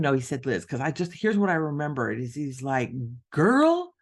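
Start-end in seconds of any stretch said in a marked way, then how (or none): 3.3-3.9 put-on high, loud, teasing girl-talk voice; sassy mocking mentor. Mentor teasing the author about her homework, loud and high. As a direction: play the blunt mentor, pitched high and loud. Mentor's blunt question, imitated.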